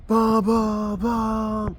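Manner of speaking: high, loud, drawn-out honk noise; long held horn blasts on one high note; imitated truck honking. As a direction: blare it out like an angry truck horn. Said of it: "he starts honking".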